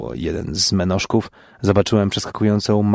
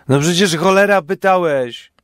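high, louder huffy reply; indignant retort; 9 semitones higher, 5 dB louder than usual. Acted. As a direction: snap back like an offended fool. Johnek retorts in other voice. (wyższa, głośniejsza urażona odpowiedź)

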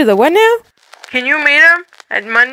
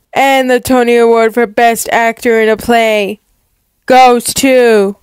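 awards announcer; 3 dB louder than usual. Acted. Tony presenter building up and calling Emma's name loudly. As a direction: draw it out, then blast the winner's name.

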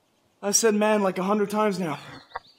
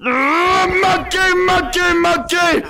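shouting; 9 semitones higher, 4 dB louder, much slower than usual. Real, not acted. Calling out during the chase.

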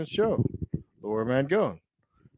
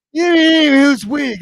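mocking yell; 12 semitones higher, 11 dB louder than usual. Real, not acted. Mocking friend, yelling loudly.

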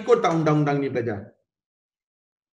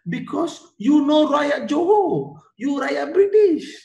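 high, slow, teasing refusal; Sultan Abu Bakar refusing; 10 semitones higher, slower than usual. Acted. Teacher voicing Sultan turning the British away, high and slow. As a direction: talk down to the British like a sly king. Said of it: "Sultan Abu Bakar kata".

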